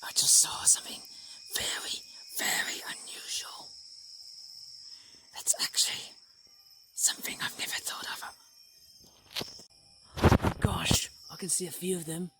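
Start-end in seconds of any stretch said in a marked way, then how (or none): 0.0-8.2 hushed whisper so the rare birds stay put; soft nature-watcher whisper, creeping close to shy birds. Real, not acted. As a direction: breathe the words without voice, barely audible. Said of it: "I'm being very quiet".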